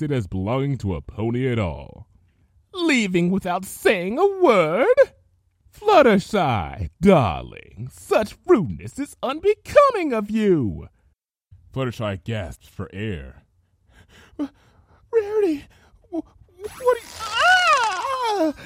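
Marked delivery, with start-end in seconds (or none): 2.7-10.9 high, slow, mocking sweetness; menacing sweet villain. Rarity taunting bound Fluttershy. 14.4-18.5 high stammering panic ending in a cry; terrified stammer. Acted. Fluttershy in pain, terrified.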